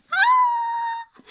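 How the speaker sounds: very high, loud squeal; excited squeal. Real, not acted. Book fan thrilled at finding Nessie, squealing. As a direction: let out a piercing squeal.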